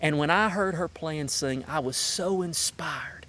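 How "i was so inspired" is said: whispering. Real, not acted. Heartfelt tribute, hushed awe.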